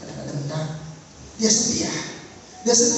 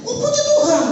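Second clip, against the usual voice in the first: much higher glad exclamation; joyful surprise; 13 semitones higher, 3 dB louder than usual. Retold or imitated. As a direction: praise God in delight. Pastor hears good news. (pendeta gembira mendengar kabar baik, seru tinggi)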